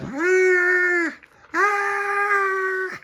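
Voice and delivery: long, piercing high shrieks; acted screams. A narrator's reading. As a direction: shriek while being thrown around. Crew thrown around.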